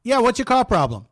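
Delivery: car radio host. Acted. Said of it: "I'll be click or clack".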